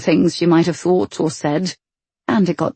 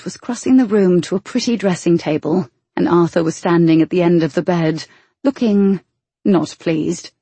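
ordinary delivery, nothing marked

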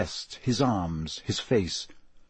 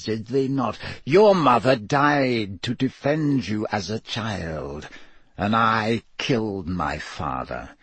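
slow, drawn-out speech with cold menace; cold, menacing villain. Acted. Dark lord taunts captive about their parents, slow and cold.

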